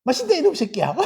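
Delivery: laughing gruff praise, swear word as a fond jab; rough Gyeongsang fondness, chuckling through the insult; affectionate swearing. Retold or imitated. Rural Gyeongsang elder praising a child's eating, laughing and gruff. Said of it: "밥만 잘 먹어도".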